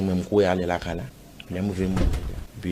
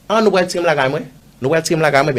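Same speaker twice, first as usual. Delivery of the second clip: retold indignant shout; 8 semitones higher, 8 dB louder than usual. Retold protest at the police.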